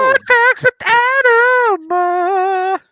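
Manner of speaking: high, loud show announcer. Pretend. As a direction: announce the segment. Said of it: "let's go".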